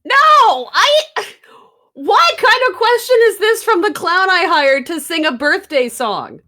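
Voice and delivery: appalled at the hired clown; indignant. Acted. Outraged at clown's questions.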